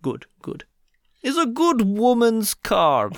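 imitated Russian seller, slow and drawn out; hammed-up Russian car salesman; Russian accent. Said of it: "I will give you the sentence".

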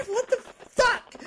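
high, startled outburst; shocked. Acted. Growing woman shocked by her changing body, high and startled. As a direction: jolt up in alarm.

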